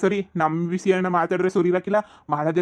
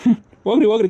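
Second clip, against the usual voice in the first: scornful dismissal; 4 semitones higher, 6 dB louder, faster than usual. Acted. Mother-in-law scornfully dismissing them.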